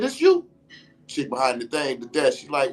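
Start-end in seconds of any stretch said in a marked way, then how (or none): 0.0-0.5 pointed question. Retold or imitated. Confronting the woman at the desk.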